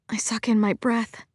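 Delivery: ordinary delivery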